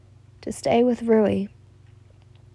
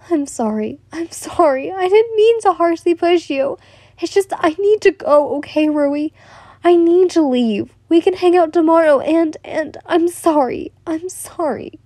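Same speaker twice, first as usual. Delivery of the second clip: higher, pleading apology; flustered apology; 6 semitones higher than usual. Acted. She apologises to the boy she pushed, higher pleading tone. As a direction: plead to go, voice lifted.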